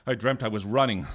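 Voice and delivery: ordinary delivery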